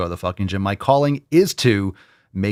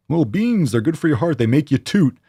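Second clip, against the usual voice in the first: mocking silly voice; 4 semitones higher than usual. Mocking a listener's excuse.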